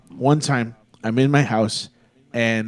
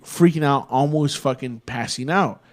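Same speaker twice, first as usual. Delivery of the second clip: ordinary delivery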